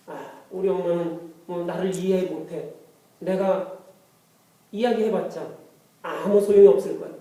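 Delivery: understated resigned grumble, low and soft; resigned child. Acted. Child thinking mother will never understand, low resigned grumble. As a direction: lightly act a child giving up, voice dropped low.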